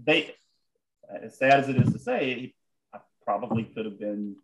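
usual speaking voice

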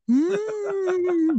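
high whale moan; whale impression. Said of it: "that's my whale impression".

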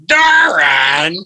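loud drawn-out yell of the name with a rise; pained yell. Retold or imitated. Friend with broken teeth yelling at the narrator, loud. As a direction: yell the name in shock and pain.

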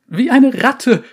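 high, loud frightened cry; shrill panicked blurt. Acted. Survivor blurts the answer.

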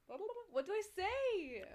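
high, loud, drawn-out wail; exaggerated stalling. Real, not acted. Stuck before an accent demo, high drawn-out playful wail. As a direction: whine it long and high, playfully helpless.

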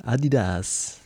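Adidas in a light mock Italian accent. Asked by a friend to try it in an Italian accent. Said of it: "Say with an Italian accent".

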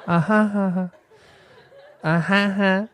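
high playful fake laugh; hammed-up ha ha laugh; mock laughter. Shown as a demonstration. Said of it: "and then I have my laughter".

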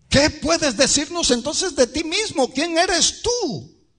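very high-pitched, pressing interrogation; insistent questioning. Acted. Acted questioners pressing Juan.

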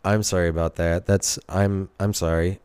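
ordinary delivery

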